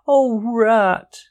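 high relieved cry of a frightened mole; a touch of a cry, high and bright; lightly voiced mole crying out. A narrator's reading. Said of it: "he cried".

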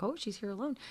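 hushed imitated aside; whispering. Visitors at the shrine looking at the lone foreigner, whispering. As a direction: murmur it very softly among onlookers. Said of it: "they're, like, kind of whispering".

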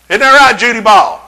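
a light mock call of the voice from the office. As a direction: play the bookkeeper calling out from the back. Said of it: "said the voice from the office".

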